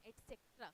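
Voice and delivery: "et cetera" softly as et-SEK-tra; common mispronunciation. Shown as a demonstration. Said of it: "हम et cetera बोलते हैं". ("et cetera" को एट-सेक्ट्रा बोलें)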